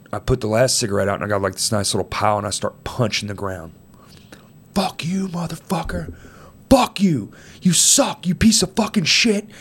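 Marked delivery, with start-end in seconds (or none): 4.7-9.5 high, strained mock scream; high-pitched imitation of furious screaming; retold screaming. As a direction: re-enact cursing at a grave, held back in volume. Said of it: "And I'm screaming".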